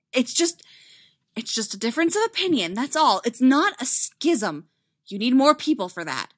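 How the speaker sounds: high, loud insistence; overwrought, emphatic protest; frantic denial. Acted.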